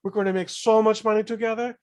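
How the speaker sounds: imitated high-pitched manager selling a deal. Manager promising [name] big money, high mock voice. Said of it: "he does have a high voice where he goes".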